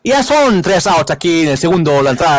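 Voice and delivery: lightly voiced Spanish play-by-play call; Tito Puentes. Guest imitates the broadcaster resuming his call after choking, light announcer voice. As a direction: slip straight back into the broadcast call. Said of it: "and he's like".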